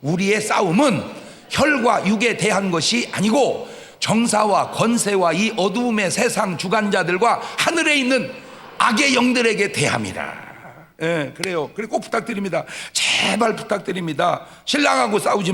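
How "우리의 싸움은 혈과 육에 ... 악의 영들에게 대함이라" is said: raised pulpit voice for the restarted verse; scripture proclamation. Shown as a demonstration. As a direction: boom the verse out to the congregation. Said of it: "그렇지, 시작".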